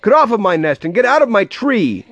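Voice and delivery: high, outraged scolding; accuse, then rush out the orders; sputtered. A narrator's reading. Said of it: "she sputtered".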